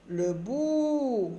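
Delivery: "bout" with a long held "ou", falling at the end; teacher's slow, sustained "bout"; elongated vowel. Shown as a demonstration. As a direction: stretch the "ou" of "bout". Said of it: "on allonge un petit peu le son".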